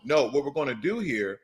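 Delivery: ordinary talk, nothing marked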